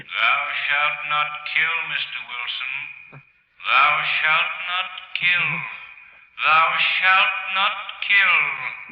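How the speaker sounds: ghostly intoning. Acted. Accusing voice intones commandment.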